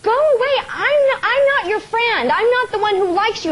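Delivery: high mock scolding; scolding squirrels. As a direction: shoo off a pest, half-playful. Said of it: "and I'll say".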